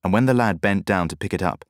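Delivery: plain reading voice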